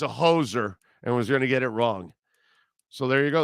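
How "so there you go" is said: normal talking voice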